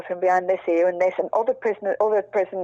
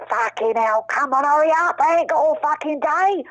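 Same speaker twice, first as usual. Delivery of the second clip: higher, louder impatient yell; bellowing voice; 9 semitones higher, 3 dB louder than usual. Retold or imitated. Retelling Charlie bellowing before she saw him, loud shout. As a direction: bark it like an impatient hard man. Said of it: "I just heard this bellowing voice".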